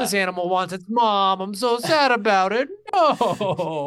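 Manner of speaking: high, loud lamenting; mock-sad whine. Reacting to sad animals in stories, hammed-up sad wail. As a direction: wail with exaggerated heartbreak. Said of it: "always makes me sad when animals are sad".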